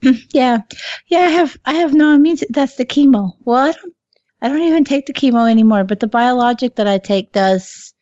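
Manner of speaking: usual speaking voice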